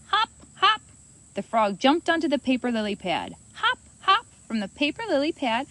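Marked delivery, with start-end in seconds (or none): 0.1-0.8 high, loud hop, hop; bouncy hop sounds. A narrator's reading. Frog hopping sound effect. 3.5-4.3 high, loud hop, hop; bouncy hop sounds. A narrator's reading. Frog hopping sound effect.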